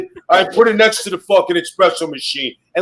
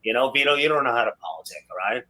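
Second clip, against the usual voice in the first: lightly imitated wrestler, low and soft; Nunzio; 8 semitones lower, 9 dB quieter, faster than usual. Lecturing Vito on politicking, lightly imitated low mutter. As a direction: mutter a know-it-all's scolding, pitched down. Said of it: "Nunzio, this fucking guy".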